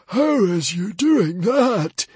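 high, loud, slow disbelief; the librarian, stunned that Stoic lives; shocked librarian. A narrator's reading. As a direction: demand it in shock.

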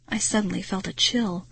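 plain reading voice

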